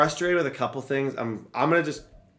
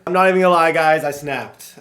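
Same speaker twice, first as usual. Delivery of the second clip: high, loud burst to the viewers; loud confession; 5 semitones higher, 10 dB louder than usual. Real, not acted. Streamer admitting to viewers he snapped, loud and high. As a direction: blurt it out, worked up.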